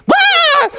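very high cartoon scream; wail of alarm. A narrator's reading. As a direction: yelp as if yanked overboard. Smee caught by the anchor.

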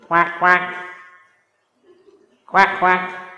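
nasal-free playful duck call impression; duck quacks. Ducks heard in the park.

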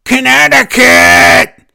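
high, loud, drawn-out mock yell of the town name; mocking Natalya shout. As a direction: ham up a wrestler shouting to the crowd. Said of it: "oh my God, her voice".

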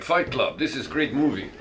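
badly faked Chinese accent that slips toward Russian.

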